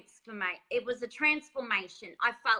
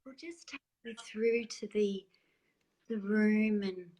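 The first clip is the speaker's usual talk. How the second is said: spa voice. Retold or imitated. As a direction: draw it out gently like a spa attendant. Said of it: "spa voice, spa voice".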